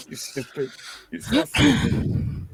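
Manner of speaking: high, slow teasing scold; playful high scold; laughing scold. Real, not acted. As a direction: tease them through a laugh. Laughing at friend's joke.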